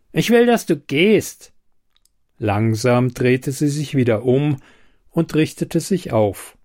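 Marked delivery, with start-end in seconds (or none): 0.1-1.5 angry. A narrator's reading. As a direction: demand "will" sharply and high. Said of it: "blaffte er".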